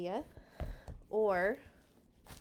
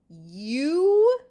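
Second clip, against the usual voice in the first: louder, higher, booming giant voice; giant's scoff; 6 semitones higher, 13 dB louder than usual. Acted. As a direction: scoff down at a small boy. Giant sneering at small boy.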